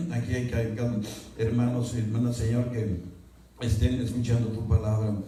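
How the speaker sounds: earnest pulpit prayer. Acted. Pastor praying over the congregation, earnest prayer. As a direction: ask the Father for guidance, humbly. Pastor prays before the sermon.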